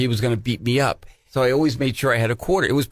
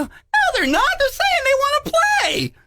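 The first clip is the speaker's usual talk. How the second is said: put-on much higher, innocent little-kid voice; naive kid self. Young [name] insisting the kids are friendly: high, innocent. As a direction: play your gullible young self, high. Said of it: "I go".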